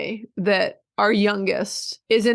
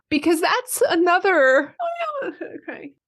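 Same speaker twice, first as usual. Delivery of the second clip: breaking into tears; 9 semitones higher than usual. Real, not acted. Wife thanking husband for the journey, breaking into tears. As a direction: voice climbing, then collapsing into a faint squeak.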